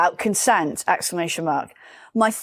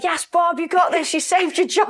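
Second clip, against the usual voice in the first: higher pitched, cheering Bob on; cheering colleagues; 9 semitones higher than usual. Retold or imitated. Office colleagues cheering Bob's big fee, excited. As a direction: hype him up like the office does. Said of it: "They're like".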